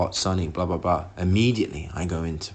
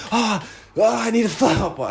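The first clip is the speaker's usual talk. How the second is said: much higher, breathless-comic announcement; panicked declaration; 11 semitones higher than usual. Retold or imitated. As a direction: announce it to the whole shop in mock desperation. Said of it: "I declare it to everyone in the garden".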